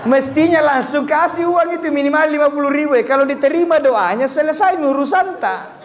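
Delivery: high, fast, laughing aside; fast joking aside, laughing; laughing joke. Real, not acted. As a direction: tease the crowd while laughing.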